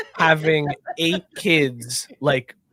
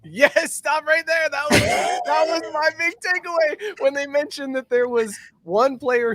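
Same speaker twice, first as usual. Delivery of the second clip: excited; 11 semitones higher than usual. Real, not acted. Seizing on Colts baby joke.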